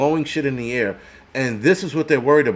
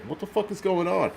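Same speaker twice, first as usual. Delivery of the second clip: stunned disbelief; 6 semitones higher, 7 dB quieter than usual. Retold or imitated. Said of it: "I was like".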